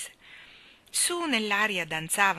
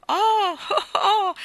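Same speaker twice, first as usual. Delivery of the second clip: acuti e forti; gridi di gioia; 9 dB louder, much slower than usual. A narrator's reading. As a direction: urla felice girando nell'aria. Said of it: "gridò Jane". (Jane grida acuta di gioia)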